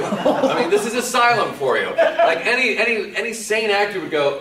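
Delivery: high, loud laughing through the words; can barely get it out for laughing. Real, not acted. Laughing at the absurd stunt.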